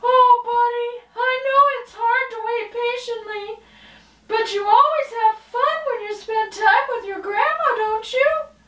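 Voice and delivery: high, fast, bubbly puppet voice; high puppet girl. Acted. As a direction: chirp it like a perky little puppet. Girl puppet comforts dog.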